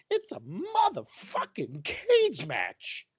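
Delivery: drawn-out, hammered-out words; emphatic exasperation. Real, not acted. Fan defending a bloody wrestling match, emphatic exasperation. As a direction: stretch each word out in exasperation.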